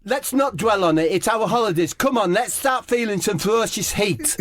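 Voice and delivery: high, brave-faced Harry Kane voice; Harry Kane rallying his teammates, high and brave-faced; Harry Kane impression. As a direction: shrug it off bravely. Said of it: "He says".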